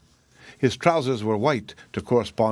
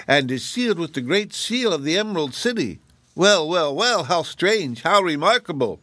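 amazed rabbit; 7 semitones higher than usual. A narrator's reading. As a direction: marvel at the royal seal. Rabbit marvels at letter.

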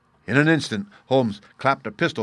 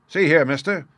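higher-pitched, suspicious challenge; suspicious cry; 6 semitones higher, 4 dB louder than usual. A narrator's reading. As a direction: call him out warily. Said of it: "he cried with a gleam of suspicion".

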